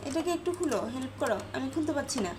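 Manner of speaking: cooing plea to Toro; coaxing pet. Real, not acted. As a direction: ask the pet to help nicely.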